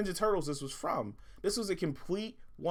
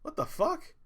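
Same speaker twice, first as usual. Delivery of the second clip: sudden high, stunned exclamation; shocked outburst; 13 semitones higher than usual. Retold or imitated. Unboxing a disappointing toy, high stunned disbelief. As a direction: react like you can't believe the toy. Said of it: "I was like".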